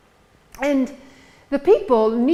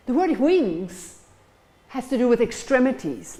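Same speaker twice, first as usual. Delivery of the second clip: usual speaking voice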